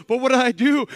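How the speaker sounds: imitated clueless bafflement; bewildered protest. American friend asking the youth pastor again, loudly bewildered. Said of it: "He goes".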